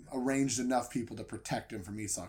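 ordinary talk, nothing marked

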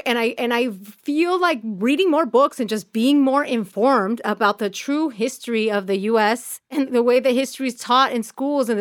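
ordinary talk, nothing marked

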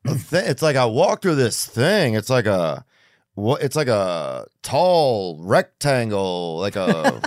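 puzzled, meandering describer; lilting, halting deadpan; Norm. Acted. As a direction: grope slowly toward naming a mysterious object. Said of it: "where Norm just describes".

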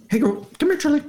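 high squeaky dog voice. Shown as a demonstration. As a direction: talk like the dog would. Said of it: "Can we hear it?".

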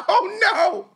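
high-pitched comic outcry; hammed-up alarm. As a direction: retell the panic high and half laughing. Retold panic at performer arriving.

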